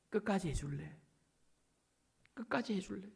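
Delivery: very soft, hushed asking; quiet plea, pausing between repeats; gentle request. Acted. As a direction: ask tenderly, almost under the voice. God gently asking, after mock scolding.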